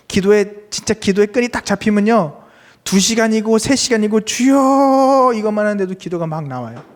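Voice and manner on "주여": long, drawn-out high cry to God; crying out in prayer. Acted. Preacher shows hours of praying one word, crying out long. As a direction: stretch the word into a fervent wail.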